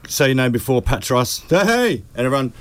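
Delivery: high-pitched run of greetings to absent friends; shout-outs. Real, not acted. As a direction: call out hellos, pitch lifted well up. String of shout-outs to absent people.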